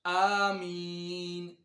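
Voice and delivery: slow, melodic tajweed recitation, drawn out; slow Quranic recitation. Shown as a demonstration. Teacher reciting slowly for students to follow. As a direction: recite each word slowly for students to repeat.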